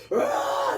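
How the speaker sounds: sudden high shout to let it out; release yell. Shown as a demonstration. Showing the talk-show audience how to let it out: loud yell. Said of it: "get it out".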